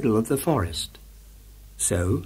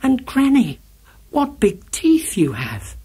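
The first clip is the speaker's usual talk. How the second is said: put-on little girl voice; 11 semitones higher than usual. A narrator's reading. Girl speaks to wolf.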